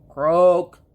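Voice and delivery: loud croak; a loud toad's croak. A narrator's reading. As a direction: croak like a toad. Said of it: "he said".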